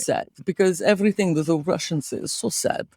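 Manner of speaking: mock-mournful Russian accent; very sad Russian. Host showing co-host the heartbreak, sad Russian accent. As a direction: sink into a gloomy, resigned Russian accent. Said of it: "I must become very sad".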